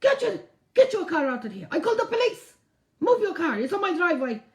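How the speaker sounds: loud, high mock yell of an angry neighbour; imitating a shouting man. Retelling the neighbour yelling at her to move her car, shouting. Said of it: "he goes to me".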